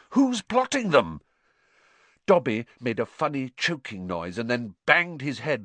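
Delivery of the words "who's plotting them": high, loud urgent query; immediate question. A narrator's reading. Harry pressing Dobby about the plot, urgent. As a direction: demand it at once. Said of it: "said Harry at once".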